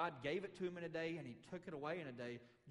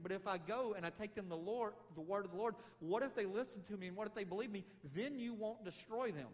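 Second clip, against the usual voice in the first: higher, louder worried protest; Jonah objecting; 6 semitones higher, 5 dB louder than usual. Acted. Jonah arguing with the Lord, higher, louder protest. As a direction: argue the objection up at God. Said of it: "He said".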